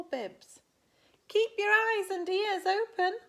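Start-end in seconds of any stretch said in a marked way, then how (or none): 1.3-3.2 high, loud magical voice; grand godmother. Acted. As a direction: proclaim it like a fairy godmother. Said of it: "said the hairy godmother".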